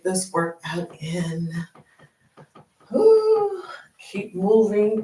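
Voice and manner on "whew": whew. Real, not acted. Winded on walking workout.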